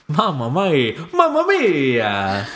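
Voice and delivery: slow hammed-up Italian exclamation; drawn-out Italian accent mamma mia; mock Italian accent.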